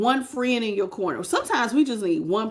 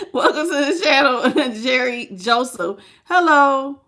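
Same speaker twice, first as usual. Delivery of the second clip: laughing; 3 semitones higher, 5 dB louder than usual. Real, not acted. Laughing while greeting viewer.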